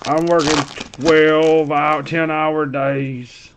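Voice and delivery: drawn-out mock whine; Irish. Imitating his complaint about long days, mock whining. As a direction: stretch the last words into a weary-sounding whine. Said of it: "y'all should hear all the whining".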